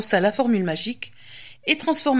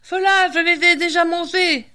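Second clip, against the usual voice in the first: high, louder wailing protest; desperate witch cry; 6 semitones higher, 4 dB louder than usual. A narrator's reading. The witch losing her chocolate children, high loud wail. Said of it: "cria la sorcière".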